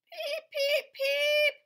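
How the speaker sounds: very high, loud little beeps; squeaky duckling peeps. Acted. Reading to a child, duckling peeping squeakily. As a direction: peep like a tiny duckling. Baby duck on tractor.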